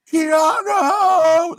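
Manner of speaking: high, loud, drawn-out shout; screamed name. Retold or imitated. Somebody suddenly recognizes the star, screamed out. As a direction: imitate a fan screaming across a hall. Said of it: "that guy screamed it out".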